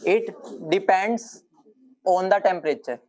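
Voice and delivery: each word drawn out for note-taking; slow dictation. Shown as a demonstration. Teacher dictating slowly to class. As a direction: dictate it slowly so they can write. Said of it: "ध्यान से लिखना".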